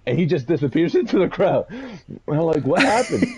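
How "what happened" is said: stunned. Retold or imitated. Retold stunned reaction.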